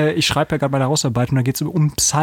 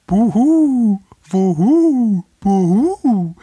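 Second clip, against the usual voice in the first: higher, louder rhythmic hooting buhu; rhythmic owl hoot; 7 semitones higher, 5 dB louder, much slower than usual. Shown as a demonstration. Reading the owl song aloud, higher louder hooting. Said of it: "ein rhythmisches Wuhu".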